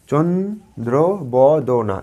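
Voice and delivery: slow, syllable-timed চন্দ্র ব দ না; split into syllables. Shown as a demonstration. Teacher demonstrating চন্দ্র ব দ না, syllable by syllable. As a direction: say it in separated beats. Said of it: "আমি এইভাবে কিন্তু এটা উচ্চারণ করতে পারছি".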